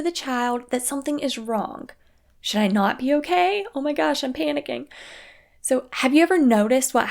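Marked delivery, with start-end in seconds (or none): 2.4-4.9 put-on child's inner panic.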